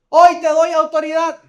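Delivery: emphatic high-pitched declaration at full force; forceful proclamation. Retold or imitated. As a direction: ring it out to the whole congregation. Preacher repeats proclamation for congregation.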